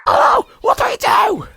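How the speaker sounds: high, loud, frantic cries; panicked shouting. Acted. Friends shouting as Ryan collapses, panicked. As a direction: alarm when a friend drops.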